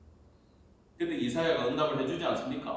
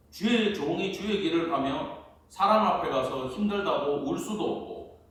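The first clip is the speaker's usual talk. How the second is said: ordinary talk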